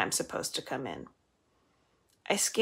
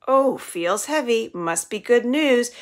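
higher, louder, slow mock sweetness; teasing; 5 semitones higher, 7 dB louder, slower than usual. A narrator's reading. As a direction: savour the tease slowly. Violet teasing with wicked glee.

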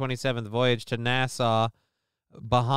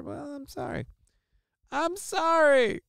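high, slow sarcastic sorry; mock apology; 13 semitones higher, slower than usual. Host mockingly apologising to chat in a high whine.

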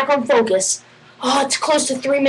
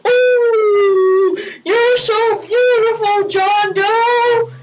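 put-on swooning girl; 7 semitones higher, much slower than usual. Girl falls for John Doe, high slow swoon. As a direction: swoon over him, high and drawn out. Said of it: "they're like".

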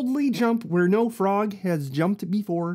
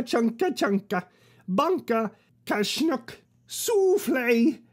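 mechanical transforming noises; 4 semitones higher, slower than usual. Acted. Transform sound effects.

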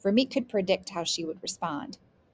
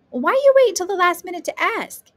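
loud, high-pitched exasperated question; scolding; 12 semitones higher, 11 dB louder than usual. Acted. Grandmother scolding grandson for asking late, exasperated. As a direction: demand an explanation.

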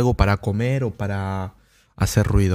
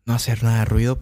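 usual speaking voice

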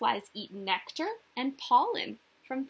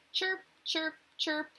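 higher imitated chirping; cricket chirp; 6 semitones higher than usual. Shown as a demonstration. Teacher mimics a cricket for children, high chirps.

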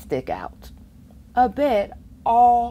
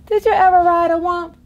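excited question; 11 semitones higher, 9 dB louder, faster than usual. Acted. Reading rhyme, loud fast.

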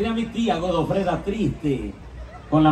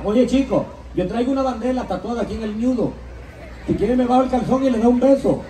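Cuban woman; 6 semitones higher, faster than usual. Acted. La negra ofrece la bandera.

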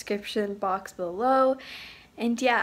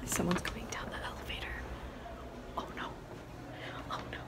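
hushed whisper, barely voiced; 3 semitones lower, 9 dB quieter, faster than usual. Real, not acted. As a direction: whisper so no one hears. Sneaking around by the elevator.

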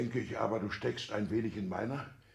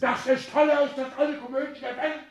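much higher, loud, near hysterical; indignant outcry; 12 semitones higher, 7 dB louder than usual. Acted. Father mocking the match as a comedy, shrill outcry. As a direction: cry it out as the height of absurdity. Father outraged at son's marriage.

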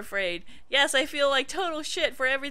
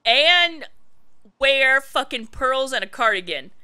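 outraged rant; 7 dB louder than usual. Real, not acted.

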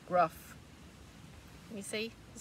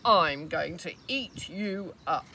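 louder, slow hungry troll; roaring troll; 2 semitones lower, 9 dB louder, slower than usual. Acted. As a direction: threaten with a roar. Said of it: "roared the troll".

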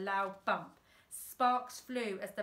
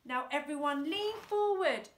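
high louder command; shouted; 6 semitones higher, 5 dB louder than usual. A narrator's reading. Dad shouting to the men in back, loud. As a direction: call the order out loud. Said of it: "shouted Dad".

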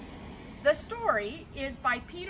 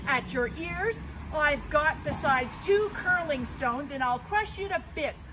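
defiant big goat; 3 semitones higher, 7 dB louder than usual. Acted. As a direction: dare the troll to come. Big goat threatens troll.

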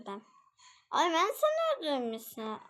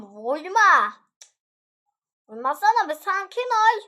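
louder, rising protest at being hit; agitated protest; 6 semitones higher, 9 dB louder than usual. Real, not acted. Player tells attackers to stop hitting, agitated protest. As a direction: push back loudly, pitch climbing.

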